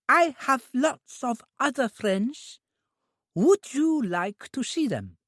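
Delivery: put-on high, slow simple girl's voice; an eager, innocent young woman; high, drawn-out childlike voice; childlike Trudy. A narrator's reading.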